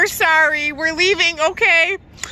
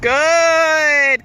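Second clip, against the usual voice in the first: usual speaking voice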